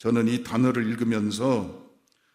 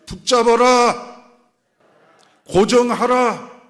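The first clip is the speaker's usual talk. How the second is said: slow, high, loud call for repetition; drawn-out preacher's battle cry; rallying shout; 12 semitones higher, 9 dB louder, much slower than usual. Real, not acted. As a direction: shout each command for the congregation to echo. Leads congregation to repeat after him.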